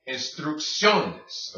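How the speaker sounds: careful instrucciones, een-strook-SYOH-nes; hard then soft C. Shown as a demonstration. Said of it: "second C soft".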